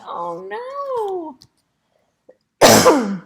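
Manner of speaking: high, drawn-out dismay; dismayed cry. A narrator's reading. Hamster whose car won't move, dismayed. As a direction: wail it lightly in dismay.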